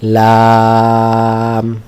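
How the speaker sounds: লাম held long on one even note; tongue tip to upper gum, then stretch লাম; drawn-out letter name. Shown as a demonstration. Teacher shows where লাম is articulated, drawn out. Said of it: "মাড়িতে লাগিয়ে বলবেন".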